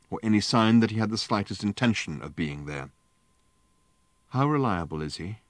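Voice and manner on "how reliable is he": quiet, dropping, losing hope; sinking voice. A narrator's reading. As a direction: ask it with a sinking heart. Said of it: "asked Fenchurch in a sinking voice".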